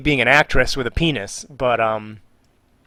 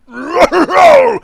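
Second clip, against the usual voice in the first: loud, gruff monster roar; gruff Koopaling; 8 dB louder than usual. Shown as a demonstration. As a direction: roar it like a rough cartoon villain. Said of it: "the gruffer sounding Koopalings".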